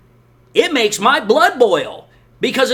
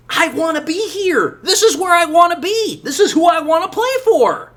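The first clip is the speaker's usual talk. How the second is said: mock eager player; 6 semitones higher than usual. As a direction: play the pleading player, half-laughing, pitched up. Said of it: "who's like".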